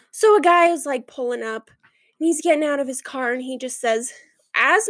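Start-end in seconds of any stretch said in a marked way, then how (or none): none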